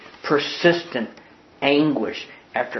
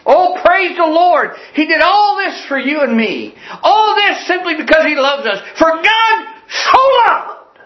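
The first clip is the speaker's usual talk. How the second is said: exultant praise; 14 semitones higher, 4 dB louder than usual. Real, not acted. Pastor rejoicing before the congregation, high ringing praise. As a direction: lift it up in rejoicing.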